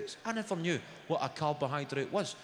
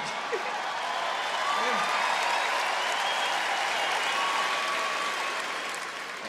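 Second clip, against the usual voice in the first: long wheezing laugh; real helpless laughter; breathless laugh; 7 semitones higher, 5 dB louder than usual. Real, not acted. Comedian cracking up at his own story, wheezing laugh.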